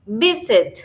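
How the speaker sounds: "Visit" with a loud VIS, then falling; "Visit" as VIS-it, first syllable forceful; stress on first syllable. Shown as a demonstration. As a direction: punch the start of "Visit". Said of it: "la fuerza se hace en la primera".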